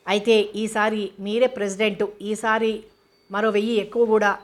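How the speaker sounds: usual speaking voice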